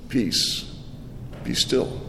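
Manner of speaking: whispered, calm authority; hushed command. Acted. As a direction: breathe it out like a hush. Jesus calms the storm.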